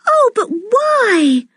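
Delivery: high, slow wail of dismay; cry of dismay. A narrator's reading. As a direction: plead slowly in high dismay. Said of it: "cried Anne in dismay".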